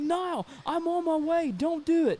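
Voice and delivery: slow, high-pitched acted pleading; urgent plea. Roommate on the phone stops him breaking in, pleading. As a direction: imitate a roommate begging him to hold off.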